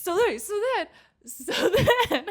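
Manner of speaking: high squealing laugh through words; can't get the words out for laughing; laughing fit. Real, not acted. Telling a friend the story, breaking into laughter. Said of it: "laughing fit".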